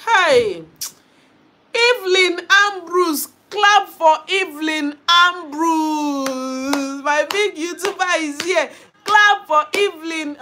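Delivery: thrilled hype for a guest; excited welcome. Real, not acted. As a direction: cheer her arrival. Hyping a guest on stream.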